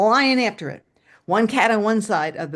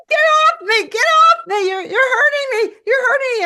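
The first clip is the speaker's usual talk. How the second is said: high, loud shrieks of alarm; panicked screaming; 9 dB louder than usual. Retold or imitated. Woman with a mouse on her shoulder, panicked screaming. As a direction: scream to shake it off.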